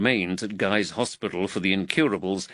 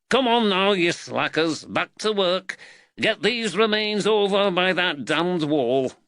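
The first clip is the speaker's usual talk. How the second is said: high-pitched, raised calling voice; rousing, impatient order to workmen; foreman's call; 9 semitones higher than usual. Acted. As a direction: call the slackers back to work. Foreman orders slacking workmen back to work.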